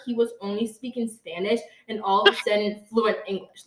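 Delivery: ordinary talk, nothing marked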